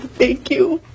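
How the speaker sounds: ordinary talk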